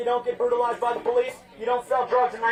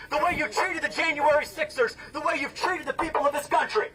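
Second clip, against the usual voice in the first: confrontational rally callout; 6 semitones higher than usual. Real, not acted. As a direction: call out your opponent before a crowd. Shouts accusations at a political opponent.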